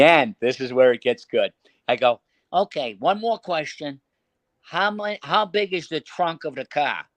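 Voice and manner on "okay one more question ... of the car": put-on higher-pitched Boston mobster accent; put-on Boston accent, mock-casual. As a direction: ask it like a Boston wiseguy on a prank call. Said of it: "put that Boston accent there".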